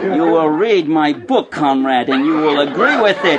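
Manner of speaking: slow, deliberate comrade voice; a hammed-up solemn party ideologue; movie communist. Student mocked as a hardcore Marxist, hammed-up movie communist. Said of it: "all the communists in the whole movie talk like this".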